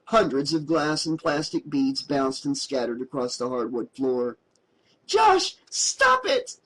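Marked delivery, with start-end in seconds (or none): 5.0-6.6 screaming. A narrator's reading. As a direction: scream it to make him stop. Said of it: "she screamed".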